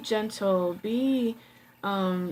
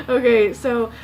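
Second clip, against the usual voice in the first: laughing; 3 semitones higher, 8 dB louder than usual. Real, not acted. Laughs at own flowery description.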